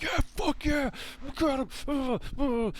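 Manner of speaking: high, loud whooping victory cheer; mock-ecstatic high-pitched yelling; nerd scream. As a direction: scream like a gamer celebrating a big win. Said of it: "fucking nerd screams all over".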